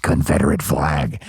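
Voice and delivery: very deep booming Bigfoot voice. Pretend. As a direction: drop way down into a monster growl. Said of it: "You've been sitting on that voice".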